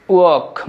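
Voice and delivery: ওয়াক for "work", r silent; British pronunciation. Shown as a demonstration. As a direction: say ওয়াক with an open vowel straight into k. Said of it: "রটা কিন্তু পরিষ্কারভাবে বোঝা যাচ্ছে".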